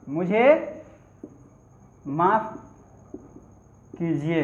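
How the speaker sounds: मुझे, माफ़, कीजिए each said alone with long pauses between; word by word. Shown as a demonstration. Teacher repeating for students, slow word by word.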